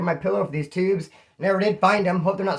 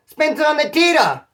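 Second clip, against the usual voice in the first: calling out on the tier; 8 semitones higher, 5 dB louder than usual. Retold or imitated. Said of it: "he's all".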